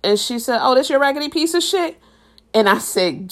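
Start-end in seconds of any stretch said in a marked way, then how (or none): 0.6-2.0 mocking. Girl mocking his car.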